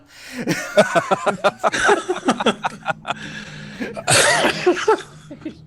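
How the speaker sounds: high, loud burst of prolonged laughter; long real laughing fit. Real, not acted. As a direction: laugh helplessly, on and on. Relieved laughter after confessing.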